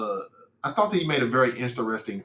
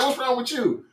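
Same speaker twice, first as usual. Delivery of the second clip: laughing; 12 semitones higher than usual. Retold or imitated. Laughing at wife's scared face.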